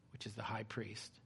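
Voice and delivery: hushed, breathy whisper; whispered aside. Acted. Preacher quoting Jesus, whispering an aside. Aside naming the guilty party.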